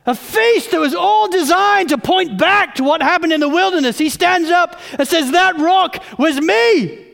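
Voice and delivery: loud, driving sermon delivery; full-force preaching. Acted. Pastor exhorting the congregation, preaching loud. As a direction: preach it out to the whole room.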